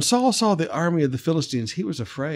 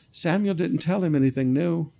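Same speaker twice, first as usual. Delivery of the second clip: ordinary talk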